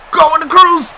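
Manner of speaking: high, loud hopeful call; excited shout. Real, not acted. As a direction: cheer the pass on.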